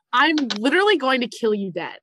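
usual speaking voice

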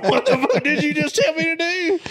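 high, loud, laughing disbelief; incredulous, laughing. Retold or imitated. A teen to the cop giving sobriety test steps, high laughing disbelief. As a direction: blurt it out half laughing in shock.

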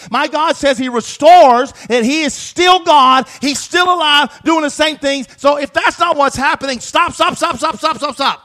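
loud, high, shouted preaching; full-force preaching. Acted. Pastor urging the congregation, shouting at full force. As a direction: drive it home like a fired-up pastor.

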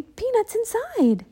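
put-on a keen girl coaxing her brother; lively Annie. Annie thrilled.

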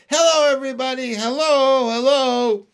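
high, loud joyful holler; calling out. A narrator's reading. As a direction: holler greetings to the crowd far below. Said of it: "laughing and waving and calling out".

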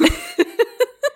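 high, amused laughter; real laugh. Real, not acted. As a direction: genuine high-pitched giggles. Laughs after telling the joke.

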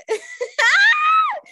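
shrieking laugh; howling with laughter; loud burst of laughter. Real, not acted. Laughing at her own story.

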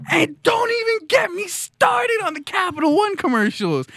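very high-pitched comic yell; outraged shout. Pretend. Comedian rants about Capital One commercials, hammed-up outraged shout. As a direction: explode in mock fury, voice way up.

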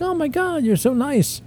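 put-on gushing girl. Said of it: "she's like".

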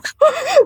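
short high giggle; a quick high burst of giggling. Real, not acted. Guest giggling at her own joke about opera troupes.

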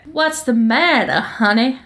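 put-on purred Southern belle accent. Ex-wife to her lover in bed, purring Southern accent. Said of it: "read what she says in your accent".

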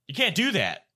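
rising, exasperated scolding nurse. Retold or imitated. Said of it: "Well, she said".

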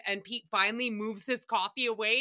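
usual speaking voice

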